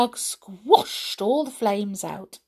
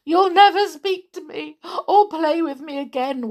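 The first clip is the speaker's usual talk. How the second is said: wept; 7 semitones higher, 3 dB louder than usual. Acted. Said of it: "wept Amelia".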